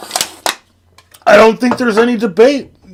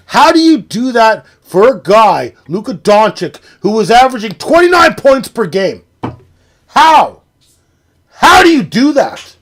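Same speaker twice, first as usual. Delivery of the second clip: loud, higher, hammering each how; furious disbelief; 4 semitones higher, 6 dB louder than usual. Real, not acted. Streamer ranting at chat about the trade, furious shout. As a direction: shout the question, raging at the trade. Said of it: "I am fucking spitting angry right now".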